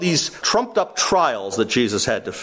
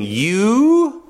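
scornful question; 4 semitones higher, 4 dB louder than usual. Retold or imitated. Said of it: "Pilate began this by saying".

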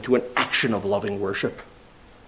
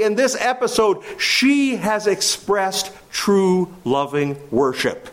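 normal talking voice

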